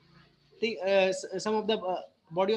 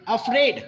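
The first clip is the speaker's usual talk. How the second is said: "Afraid" with a tiny अ and a strong Fred; stress on second syllable; 5 dB louder than usual. Shown as a demonstration. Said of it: "emphasizing more on the afraid". ("Afraid" में Fred पर ज़ोर)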